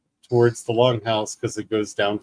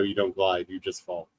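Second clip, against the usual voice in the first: ordinary talk, nothing marked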